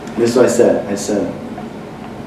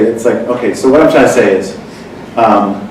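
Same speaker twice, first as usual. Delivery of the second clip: normal talking voice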